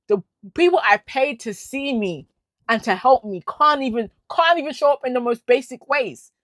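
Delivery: furious rant. Real, not acted. Ranting at the psychiatrist over the botched report, real fury. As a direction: let the real anger pour out, loud and high. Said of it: "When I tell you I'm furious".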